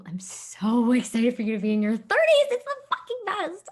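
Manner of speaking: bursting with delight, voice jumping high on best; excited gushing. Real, not acted. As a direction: gush with a laugh, thrilled for her. Gushing about friend turning thirty.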